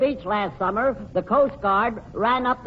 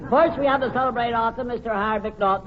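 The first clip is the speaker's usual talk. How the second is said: normal talking voice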